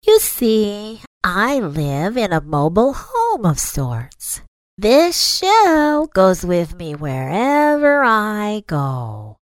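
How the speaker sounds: high, very slow turtle voice. A narrator's reading. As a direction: drag each word out slowly. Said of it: "the turtle said very slowly".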